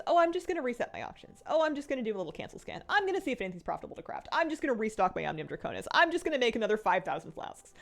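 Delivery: fast, breezy self-mockery; rattling off excuses. Mocking her own procrastination habit, fast and breezy. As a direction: rush through the excuses lightly.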